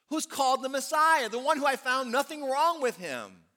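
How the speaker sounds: loud, high, demanding Pilate; Pilate questioning the crowd. Acted. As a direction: boom it out to the crowd as an incredulous governor. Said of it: "he asked them".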